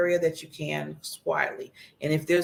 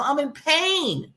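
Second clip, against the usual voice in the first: higher, slow, hurting voice; mild imitation of a hurting person, slow and high; pained cry for help; 7 semitones higher, 3 dB louder, slower than usual. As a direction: voice someone in pain reaching out. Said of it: "somebody shouts out".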